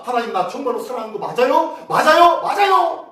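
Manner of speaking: put-on childish tantrum cry to God; whining tantrum. Shown as a demonstration. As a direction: nag like a child throwing a fit. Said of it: "땡깡 부르듯이 외치면요".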